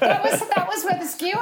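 laughing while talking; embarrassed laugh through the words. Real, not acted. Caught out, laughing at himself.

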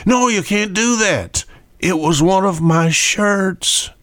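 mock-distressed husband pleading with his wife. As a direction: beg to save the old shirt. Husband protesting about his shirt.

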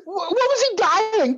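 can't believe what you hear; mock baffled. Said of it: "I'm like".

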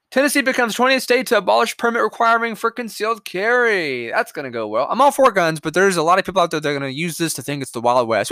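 ordinary delivery

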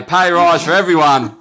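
playfully proclaimed to a room; mock announcement. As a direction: imitate someone grandly announcing it. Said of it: "You can't just walk in and say".

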